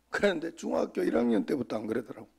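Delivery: normal talking voice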